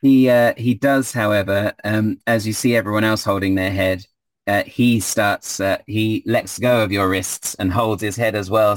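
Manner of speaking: normal talking voice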